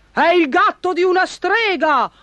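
very high-pitched alarmed yell; baron's high, urgent shout. A narrator's reading. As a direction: cry it out at the top of the voice. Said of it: "urlò il barone".

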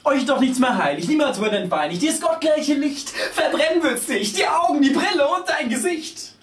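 put-on high, loud lamenting old woman; grandma wailing at the child, high and loud. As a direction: wail over the youth of today like a granny. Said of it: "und jammert".

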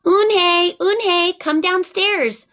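high, loud call up the stairs; mother calling her child down; calling upstairs. A narrator's reading. Mother calls up the stairs to Eunhae, loud and high. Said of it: "Mother called up to Eunhae".

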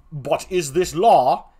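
drawn-out put-on voice; second clown. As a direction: question it as the doubting clown.

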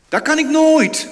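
loud high-pitched protest. Retold or imitated. Listener protesting he cannot pray for enemies, loud. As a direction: refuse it out loud.

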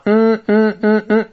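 "嗯嗯" as repeated hummed n sounds; repeated "嗯嗯", a pure front-nasal n. Shown as a demonstration. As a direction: hum "嗯嗯" as n, relaxed tongue resting on the palate. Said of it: "舌头放松的状态贴住上颚发".

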